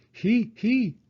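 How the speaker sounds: short high giggle; a quick high "He, he". A narrator's reading. Little pig giggling at Daddy's answer.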